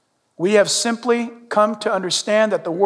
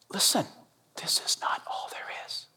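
hushed, urgent whisper to the church; 14 dB quieter than usual. Real, not acted. Pastor whispering to his church to draw them in. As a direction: lean in and breathe it.

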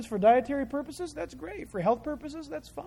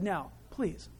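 softer, mock-gentle asking; polite request; 3 semitones lower, 8 dB quieter than usual. God addressing a lady on the roof, polite request. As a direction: ask daintily, as if to a lady. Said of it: "if this was a lady on the roof".

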